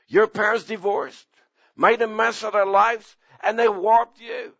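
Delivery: high, hammering preacher's cry; preaching at full force. Acted. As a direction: preach it out to the back pews.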